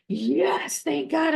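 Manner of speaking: finally heard, relief; relieved son. Retold or imitated. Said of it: "he goes".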